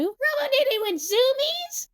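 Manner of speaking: high, drawn-out exclamation; amazed shout. A narrator's reading. Emu watching the robot zoom away, amazed shout. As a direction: call it out high and slow in amazement. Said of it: "shouts Emu".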